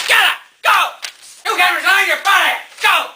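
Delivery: furious shout. Acted. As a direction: bellow the firing at him. Nixon fires his aide.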